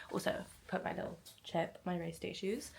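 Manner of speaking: hushed early morning voice; whispering. Real, not acted. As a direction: whisper it softly. Quiet early morning talk.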